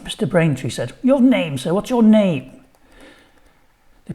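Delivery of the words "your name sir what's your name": high, slow, fussy headmaster; prim head teacher asking, drawn out; headteacher voice. A narrator's reading. Headteacher asks stranger's name.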